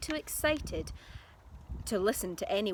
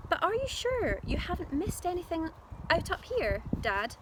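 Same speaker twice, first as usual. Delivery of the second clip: put-on higher, louder boy's voice questioning his dad; the careful son checking, higher pitched; doubtful little George; 10 semitones higher, 4 dB louder than usual. A narrator's reading.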